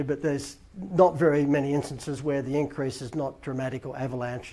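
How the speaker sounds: ordinary delivery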